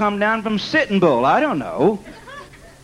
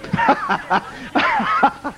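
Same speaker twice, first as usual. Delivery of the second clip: high laugh. Real, not acted. Preacher laughs after story.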